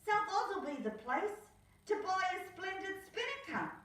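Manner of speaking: quick bright Nell; Nell's voice. Acted. Nell planning a new sail, high quick voice. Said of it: "said Nell".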